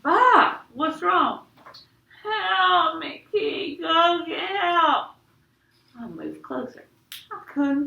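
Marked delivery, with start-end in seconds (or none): 0.0-1.5 high, loud, worried cry out; alarmed call. Acted. Narrator calls to crying brother Byron, alarmed. 2.2-5.1 high, slow muffled-sounding plea; drawn-out wail for help; pleading for help. Acted. Stuck brother begs Kenny for help, pleading.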